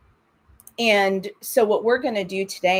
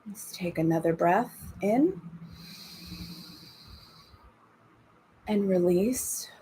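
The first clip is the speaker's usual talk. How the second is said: calm, unhurried breathing guidance; guided meditation. Acted. Leads breathing and body scan.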